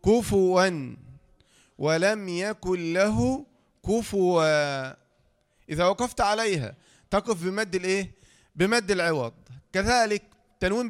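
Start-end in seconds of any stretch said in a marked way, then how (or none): 0.1-5.1 كفوا recited very slowly; slow recitation. Shown as a demonstration. Teacher recites the example slowly. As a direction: draw out كفوا like Quran recitation. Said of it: "لو وصلنا هذه بكلمة".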